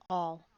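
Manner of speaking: ALL hit low and loud; great emphasis. A narrator's reading. King insisting to Alice, heavy stress on All. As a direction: land ALL like a hammer. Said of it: "He repeated with great emphasis".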